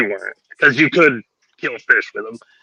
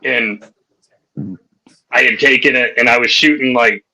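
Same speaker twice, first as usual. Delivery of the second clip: normal talking voice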